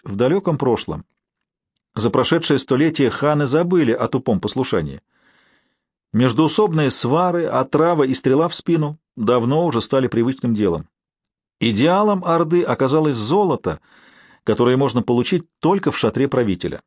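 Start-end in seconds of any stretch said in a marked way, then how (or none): none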